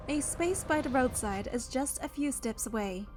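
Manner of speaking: ordinary talk, nothing marked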